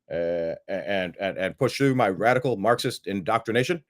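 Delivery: lightly voiced Obama, full of stammering uhs; a hint of a halting politician, uh after uh; mock Obama. Mocking Obama plotting from the basement, stammering lightly. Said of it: "He goes".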